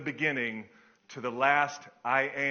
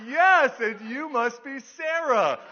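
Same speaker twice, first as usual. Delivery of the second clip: high, loud, drawn-out reply; mock enthusiasm; 9 semitones higher, 8 dB louder, slower than usual. Pastor answers the bubbly secretary, acted over-eager greeting. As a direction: gush right back at her. Matching her gushing greeting.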